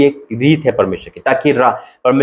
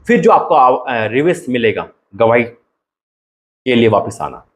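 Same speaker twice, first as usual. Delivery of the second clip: ordinary talk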